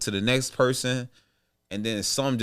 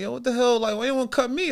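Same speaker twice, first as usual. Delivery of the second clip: high-pitched retold disbelief, at normal volume; indignant protest; 12 semitones higher than usual. Player reacting to talk of cutting him, indignant.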